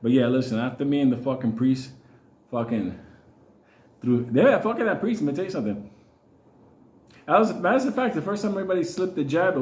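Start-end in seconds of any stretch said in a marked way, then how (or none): none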